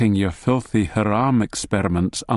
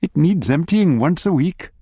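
bright wife voice; 6 semitones higher than usual. A narrator's reading. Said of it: "she says brightly".

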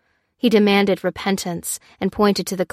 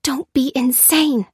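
higher, slow sharp hiss; 6 semitones higher than usual. A narrator's reading. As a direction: scold him under her breath. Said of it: "hissed Lucy".

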